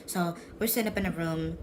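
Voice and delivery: ordinary talk, nothing marked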